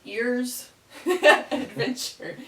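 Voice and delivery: laughing. Real, not acted. Laughing at own word choice.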